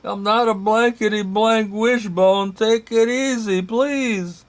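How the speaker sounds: high, strained pleading shout; exasperated yell. Acted. Stuck narrator yelling at the rescuers, high pleading. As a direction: beg them to take it easy. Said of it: "I yelled".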